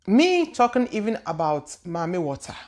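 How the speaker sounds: ordinary talk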